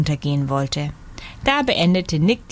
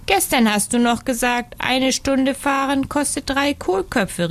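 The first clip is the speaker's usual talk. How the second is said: mildly indignant, higher-pitched protest; 7 semitones higher than usual. A narrator's reading. Hare protests to Nick about the price, mildly indignant. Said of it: "rief einer empört".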